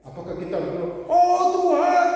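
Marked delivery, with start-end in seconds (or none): 1.1-2.1 high, loud cry of "Oh Tuhan"; sudden high, loud mock cry to heaven; crying out to God. Shown as a demonstration. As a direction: call out to God like a desperate plea. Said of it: "berdoa tapi tidak teriak".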